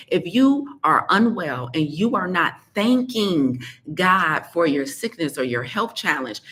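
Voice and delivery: ordinary talk, nothing marked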